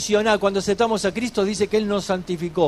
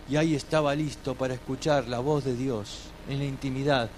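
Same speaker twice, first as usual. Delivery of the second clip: normal talking voice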